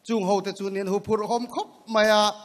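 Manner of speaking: ordinary talk, nothing marked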